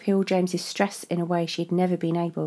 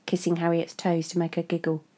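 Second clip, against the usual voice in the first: ordinary delivery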